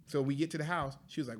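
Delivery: ordinary delivery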